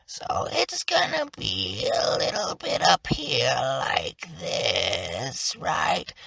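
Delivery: strangle the voice as you talk; strangled voice. Shown as a demonstration. Teacher showing players the strangled hag throat. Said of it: "You want to strangle that voice".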